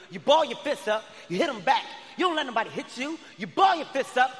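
dad telling his son to fight back; scolding. Retold or imitated. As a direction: drill it into him hard.

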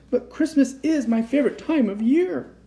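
high-pitched, bouncy Santa voice; gleeful Santa. A narrator's reading. Santa bouncing indoors with presents, gleeful. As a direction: bubble over with Christmas glee.

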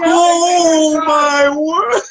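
drawn-out gasp of shock. Real, not acted. Reacting to the wax rip.

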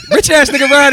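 shouted high through laughter; laughing drop. Pretend. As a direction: belt the name while cracking up. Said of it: "what's my drop?".

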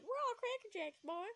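high mock exclamation; silly high voice. Narrator quoting her own slip, silly high voice. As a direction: repeat it high and goofy. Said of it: "I don't know why I said".

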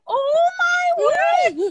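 very high, loud, drawn-out squeal; hammed-up heart-melted cry, slow and high; overjoyed mock gushing. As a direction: gush it like a melted, delighted dad. Dad's delighted reaction acted out.

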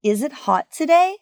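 yes-or-no question, lifting up through "today"; rising question intonation. Shown as a demonstration. Teacher demonstrates a yes-or-no question, rising intonation on "today". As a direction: pitch climbing high on "today". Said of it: "the intonation rises at the end".

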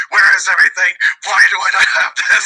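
imitated freak-out, pitched high; frantic. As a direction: act out a panicked search. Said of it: "I was looking frantically at all my stuff".

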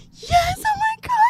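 very high, drawn-out squeal; mock ecstatic whimper. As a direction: squeal it like you're overwhelmed. Acted overwhelmed reply in bed.

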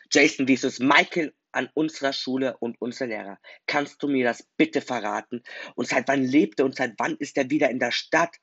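loud, accusing yell at her friend; a girl yelling at the friend who lied to her; shouting at him. Acted. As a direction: shout the questions at him in disbelief. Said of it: "schrie sie mich an".

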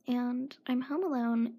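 usual speaking voice

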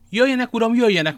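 loud, high, hearty summons; good and loud. A narrator's reading. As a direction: call them in for all to hear. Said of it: "mondta D'Artagnan jó hangosan".

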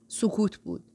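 plain reading voice

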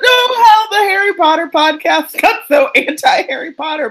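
high-pitched wail through laughter; laughing lament. Real, not acted. Co-host bemoaning the anti-Harry podcast, wailing with laughter. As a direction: protest loudly, cracking up.

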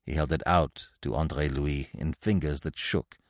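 ordinary delivery, nothing marked

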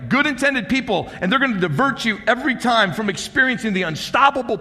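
full-force sermon climax; fiery preaching. Acted. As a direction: drive it home from the pulpit.

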